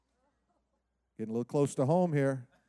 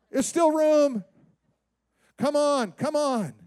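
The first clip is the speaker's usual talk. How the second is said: excited servant; 8 semitones higher, 4 dB louder, slower than usual. Retold or imitated.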